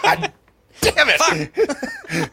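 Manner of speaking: high, loud mock frustration breaking into laughter; exasperated outburst. Driver hearing his plate light is out, exasperated.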